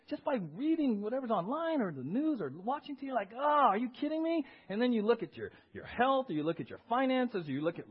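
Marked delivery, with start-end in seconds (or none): 3.3-4.5 high-pitched incredulous outburst; mock exasperation. Preacher voices someone riled by watching TV, mock exasperation. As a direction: play the riled-up viewer, voice pitched up. Acted reaction to TV news.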